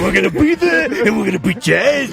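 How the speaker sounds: hyped-up wrestler shouting a promo; wrestling promo. Retold or imitated. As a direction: pump it up like a pro wrestler. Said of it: "This is doing a wrestling promo".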